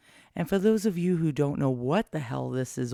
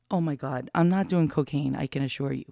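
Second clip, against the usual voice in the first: usual speaking voice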